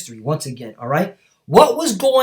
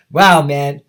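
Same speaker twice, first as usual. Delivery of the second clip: usual speaking voice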